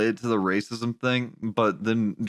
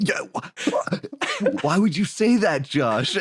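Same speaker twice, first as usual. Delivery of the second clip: mock shocked; 5 semitones higher, slower than usual. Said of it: "And I was like".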